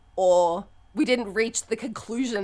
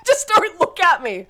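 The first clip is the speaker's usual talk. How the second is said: laughing plea; 9 semitones higher, 7 dB louder than usual. Retold or imitated. Laughing through own tearful quote.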